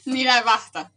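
ordinary delivery

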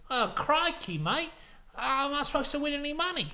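high, put-out Australian whine; protesting Australian [name]. Acted. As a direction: complain in a hammed-up Australian voice. [name] protests the dollar limit.

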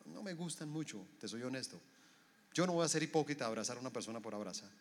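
low-key, soft brush-off; reluctant excuse. Acted. Person refusing to give a hug, reluctant and quiet.

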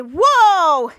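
loud, drawn-out whoa; startled whoa. Retold or imitated. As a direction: let the exclamation swell out loudly. Acts out character's startled exclamation.

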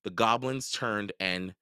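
plain reading voice

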